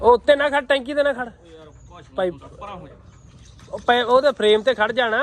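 high, loud calls across the field; shouted instructions. Real, not acted. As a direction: shout warnings to him up top. Shouting instructions to man on tanker.

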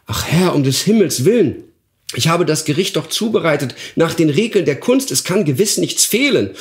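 begging his master for mercy; pleading dwarf. Acted. Dwarf pleads with the duke, frightened.

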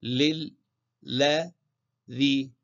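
لل then لا then ذي, each syllable said separately; doubled lam split apart. Shown as a demonstration. Teacher splitting the doubled lam of الذي into syllables, slow and separate. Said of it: "اللا مشددة، فإذا نفك التشديد".

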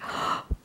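sharp hushed gasp; breath caught in shock. Real, not acted. Fan gasps at the final Asha photo card.

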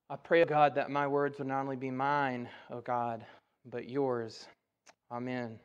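low, soft prayerful delivery. Real, not acted. Preacher praying before the sermon, quiet prayer.

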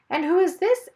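sweet high princess voice. Acted. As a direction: ask like a charming princess. Princess speaks.

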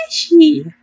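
put-on very high-pitched baby-talk squeak. Friend correcting the impression, baby voice. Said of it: "she did it in a baby voice".

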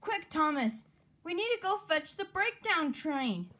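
high, urgent call for help; urgent cry. A narrator's reading. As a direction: urge your friend on in a high voice. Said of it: "cried Henry".

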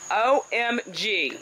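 drawn-out, dismayed O M G; slow stunned OMG. Real, not acted. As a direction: spell it out slowly in disbelief. Finding the dug-up garden bed.

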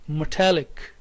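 "metallic" with the beat on "tal"; stress on -tallic. Shown as a demonstration. Teacher models the third word, stress on -tallic. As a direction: say "metallic" with a light "me" and weighted "tallic". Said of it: "primary stress is here on talic".

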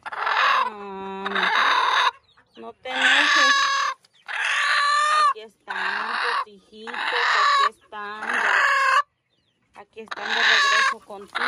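high, loud, half-crying pleas; panicky squeals. Real, not acted. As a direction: squeal and beg while being pecked.

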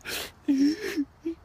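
high-pitched fake sob; hammed-up wail; mock crying. Thug breaking down before his girlfriend, mock crying. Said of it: "you ever saw a man cry".

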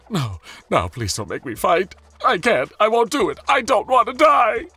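high, loud panicked sobbing little voice; sobbing tiny toy voice. A narrator's reading. Tiny spinning top begging not to fight, sobbing high and loud. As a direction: beg for its life as it flees. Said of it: "it sobbed".

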